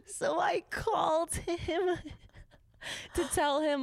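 high, slow words squeezed through laughter; real helpless giggling speech; laughing. Real, not acted. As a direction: struggle to talk while cracking up. Cracks up telling own story.

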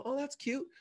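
high, sugary put-down; mock condescension. Mimics people belittling a salon owner.

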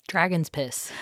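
said with no emotion whatsoever; totally straight-faced, flat delivery; deadpan. Real, not acted. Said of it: "you said dragon's piss so straight-faced".

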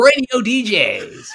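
high, loud announcer sweep; mock nineties radio DJ. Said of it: "Is it like the".

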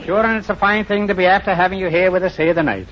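put-on a light, lilting stage-Irish accent; mock Irish brogue. Host welcomes the couple in a jokey stage-Irish brogue. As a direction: play the comic Irishman in a rusty brogue. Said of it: "How's my brogue?".

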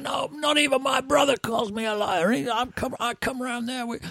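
high, strained imitation of a furious promoter; distant yelling down a phone, kept soft; mock mental rant. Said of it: "the promoter going absolutely mental".

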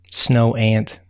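ordinary delivery

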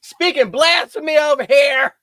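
hammed-up indignation, rising to a high, loud finish; mock scolding. Friend scolding co-host for calling herself old, mock outrage.

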